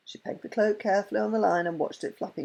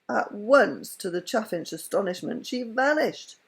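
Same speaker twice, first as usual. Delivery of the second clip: ordinary delivery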